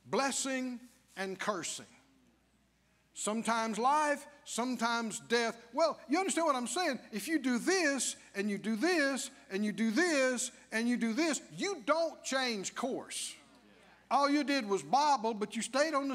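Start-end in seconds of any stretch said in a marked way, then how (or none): none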